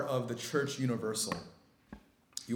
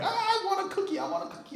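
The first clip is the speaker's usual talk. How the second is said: put-on high-pitched whiny child voice; screaming little daughter; faster than usual. Preacher mimics his daughter begging for a cookie in a high mock wail. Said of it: "my daughter writhing and screaming".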